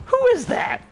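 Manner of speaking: very high mock-panicked demand; alarmed challenge. Retelling friend's reply to an intruder, alarmed challenge. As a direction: imitate a startled squeal at an intruder.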